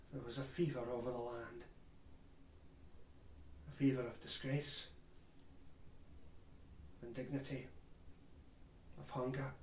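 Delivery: hushed, low and heavy; low, sombre testimony; grave recollection. Acted. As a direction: recall the shame softly, with long pauses. Solemn recollection of national shame.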